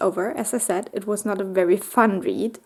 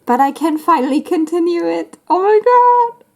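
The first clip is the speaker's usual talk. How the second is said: high, squealing thrill; excited; 9 semitones higher, 5 dB louder than usual. Real, not acted. Fan can finally continue a beloved series, squealing with excitement. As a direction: let it burst into a loud, high squeal. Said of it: "I'm so excited".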